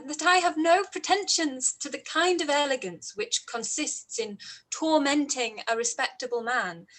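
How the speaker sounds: ordinary talk, nothing marked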